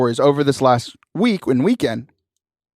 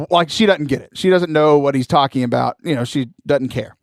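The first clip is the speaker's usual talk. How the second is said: ordinary delivery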